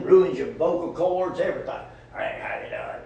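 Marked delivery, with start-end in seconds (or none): 2.2-3.0 much lower, rough smoker's hello; raspy old smoker voice. Retold or imitated. Preacher mimics an old smoker greeting him on the job, low and rough. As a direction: growl it like a woman with ruined vocal cords. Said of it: "He talked like that".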